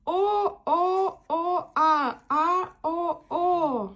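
evenly chanted, held О and А; vowel demonstration. Shown as a demonstration. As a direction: model each О and А slowly for children. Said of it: "Як я показую".

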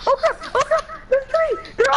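panicking gamer. Real, not acted. Ambushed by three enemies.

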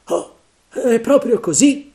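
loud, high eager agreement; relieved pirate. Acted. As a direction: grab the comforting idea.

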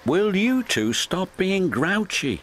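scolding. A narrator's reading. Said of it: "Mavis scolded".

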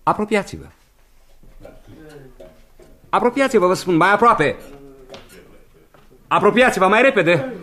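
a loud, insistent summons; calling out. Acted. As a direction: call them nearer, half-threatening. Calling the figure closer.

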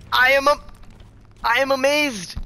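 high mock amazement; amazed. Real, not acted. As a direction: exclaim in disbelief.

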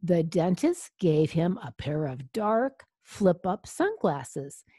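plain reading voice

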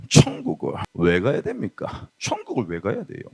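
very low, hushed pastor voice; old preacher's oratory. Acted. Youth preacher demonstrates the old pastor's shifting delivery, low and hushed. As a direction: drop deep and soft, a rhetorical question. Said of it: "높낮이 바꾸고 텐션을 바꾸는 거야".

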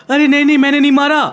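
nervous impostor blurting a denial; panicked fake voice. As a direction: deny it quickly, voice slipping into disguise. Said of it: "तुम्हारी आवाज को क्या हो गया?".

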